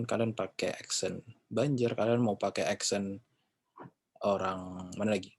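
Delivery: ordinary talk, nothing marked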